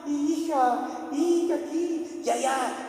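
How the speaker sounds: high, drawn-out imitated wail; mourners' wailing. Women mourners crying over the dead girl, imitated high wail.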